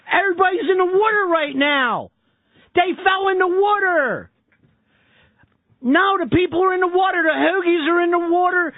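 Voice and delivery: put-on high, whiny Philadelphia accent talking down to a confused dispatcher; exasperated Philly accent. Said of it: "goofiest fucking accent I've ever heard".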